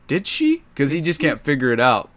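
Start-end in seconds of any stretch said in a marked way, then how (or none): 0.1-0.6 squeaky disbelieving question; mock Huey's high shocked disbelief; high-pitched surprised question; incredulous. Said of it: "And he goes".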